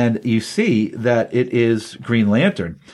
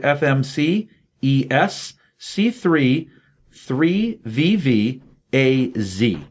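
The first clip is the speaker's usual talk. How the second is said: slow and spaced, one character at a time; slow dictation; much slower than usual. Shown as a demonstration. Reading the redeem code to listeners, slow and spaced. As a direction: spell it out slowly so listeners can copy it.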